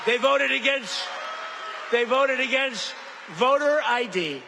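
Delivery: loud, high rally shout, slowing on the second line. Real, not acted. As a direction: hammer it out to the crowd. Rally crowd, shouted attack line.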